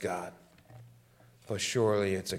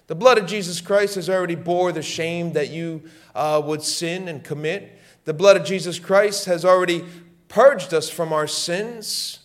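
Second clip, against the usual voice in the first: loud, rising proclamation of the blood; fiery preaching; 7 semitones higher, 7 dB louder than usual. Real, not acted. Preacher proclaiming the blood of Jesus, loud and rising. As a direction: build it like a revival call.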